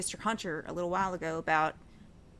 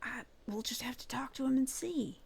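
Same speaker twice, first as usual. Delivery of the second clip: whispering; 4 semitones higher, 5 dB quieter than usual. Pretend. Whispered plan before the meeting.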